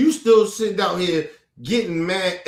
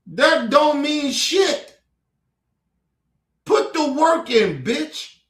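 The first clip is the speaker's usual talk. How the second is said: angry emphasis; 7 semitones higher, 3 dB louder, slower than usual. Real, not acted. Ranting at racist ex-MAGA woman.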